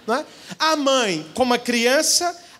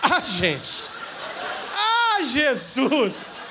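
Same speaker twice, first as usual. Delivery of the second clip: laughing; 4 semitones higher, much slower than usual. Real, not acted. Laughing at the memory.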